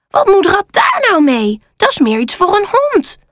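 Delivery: high-pitched, unimpressed crow; high storybook crow objecting; dismissive crow. A narrator's reading. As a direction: scoff at the gift in a high voice. Kou dismisses the dog's stick.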